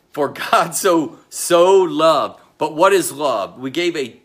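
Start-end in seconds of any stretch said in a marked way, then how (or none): 0.1-2.4 loud and slow, leaning on each word; booming proclamation. Acted. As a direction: proclaim it to the back row. Preacher proclaiming the key verse.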